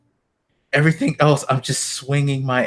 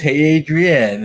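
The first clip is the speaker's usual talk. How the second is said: mildly raised, drawn-out mock yell; imitated scream; 5 semitones higher, 5 dB louder, slower than usual. Fan retelling the game's joke, mock scream. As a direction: call it out louder, stretching the words. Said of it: "when he screams".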